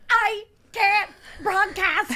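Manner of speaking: high, slow whiny voice; whiny mock complaint. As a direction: whine it like a tantrum. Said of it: "I literally was like".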